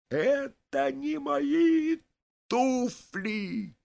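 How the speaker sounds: very high, stretched-out wail; crying out. A narrator's reading. Vaska sees his shoes have changed, crying out in protest. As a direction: wail it in high-pitched disbelief. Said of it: "кричит он".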